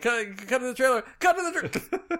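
high, loud frantic laughing shout; frantic clownish yell, breaking up; silly clown. Retold or imitated. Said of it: "the girl's like".

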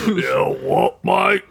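mock English troll, jumping up on the last word; troll-like English accent. Echoing co-host's Hobbit troll, mock English accent. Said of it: "English accent is trolls from The Hobbit".

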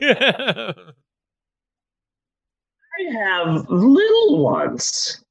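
very drawn-out, dazed wonder; stoned dad. Retold or imitated. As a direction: stretch each word as if just discovering it. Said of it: "I go like this".

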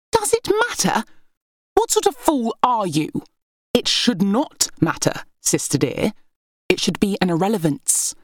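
high, arrogant royal sister sneering down; scornful heir. Acted. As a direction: lord it over your sisters with scorn. Berenice's scornful tirade.

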